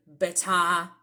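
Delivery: "better" with a British open ending; British-accented "better"; British pronunciation. Shown as a demonstration. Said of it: "British people often say the word".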